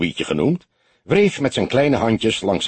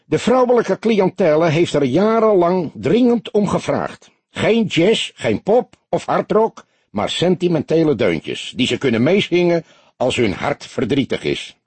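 higher, lighter little innkeeper voice; small barkeeper voice; 8 semitones higher than usual. A narrator's reading. Barkeeper explaining the music to the detective, lightly higher. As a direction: play him small and eager.

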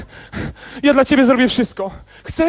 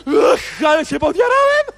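ecstatic laughing shout. Acted. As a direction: burst out with delight. Radość z obietnicy papierosa. (radosny, głośny okrzyk ze śmiechem)

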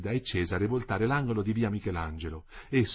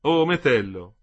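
louder ironic exclamation; exclaiming; 3 semitones higher, 8 dB louder, slower than usual. A narrator's reading. Wife teases her husband affectionately, exclaiming. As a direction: cry his name in affectionate teasing. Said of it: "lei esclamò".

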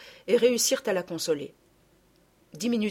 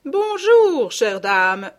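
loud, much higher gushing hello; exclaimed greeting; 5 semitones higher, 9 dB louder, much slower than usual. Acted. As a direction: cry out a big welcome. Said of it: "s'écria madame Ficquini".

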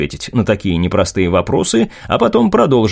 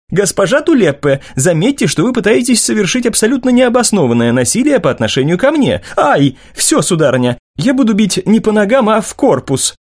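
put-on young man voice; 10 semitones higher than usual. Martin protesting, voiced high.

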